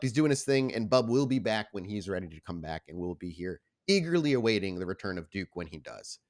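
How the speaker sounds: ordinary delivery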